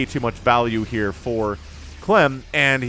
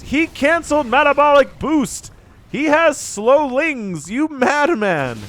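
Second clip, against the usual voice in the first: high, loud, slowed-down astonishment; stunned delighted caster outburst; amazed excitement; 12 semitones higher, 4 dB louder, slower than usual. Real, not acted. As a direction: marvel at the madman move out loud. Caster stunned by Slowlings discovery.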